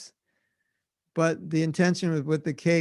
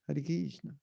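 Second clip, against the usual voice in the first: quiet attempt at an Italian accent; unsure Italian-accented "Hare Krishna", under the voice; 9 dB quieter than usual. Shown as a demonstration. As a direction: try "Hare Krishna" softly as Italian, KEEZ-na. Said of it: "Or is that Italian?".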